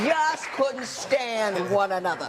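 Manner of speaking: slow, laughing, leaning on every word; laughing emphasis. Retold or imitated. To the couple, laughing about hating her husband back then.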